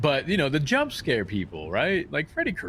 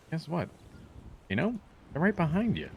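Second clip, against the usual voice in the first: usual speaking voice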